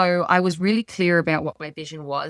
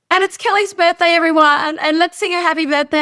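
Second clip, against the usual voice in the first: much higher and louder, mum's bubbly mic call; mum's announcement; 10 semitones higher, 7 dB louder than usual. Retold or imitated. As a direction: announce it like an excited mum at a party. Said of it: "she goes".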